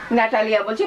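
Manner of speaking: normal talking voice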